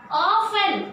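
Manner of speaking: "often" said slowly as OFF-en; "often" drawn out, T silent; dropped T. Shown as a demonstration. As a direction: slowly model "often" without the T. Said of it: "Again, T I am dropping".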